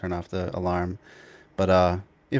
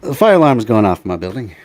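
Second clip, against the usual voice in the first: loud, raised voice over a blaring alarm; shouting over fire alarm; 13 dB louder than usual. Real, not acted. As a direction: call it out over the noise. Fire alarm going off nearby.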